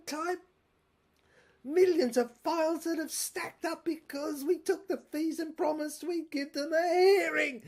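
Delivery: high, loud, unhinged tycoon rant; a shrill, loud madman's tirade; ranting villain. Acted. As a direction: rave like a cornered mogul losing his grip. Raving villain monologue.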